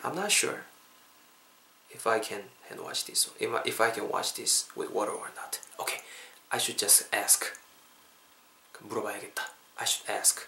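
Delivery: low, soft musing to oneself; slow, quiet thinking aloud, unsure; talking to oneself. Acted. Learner wondering alone whether to ask, quiet self-talk.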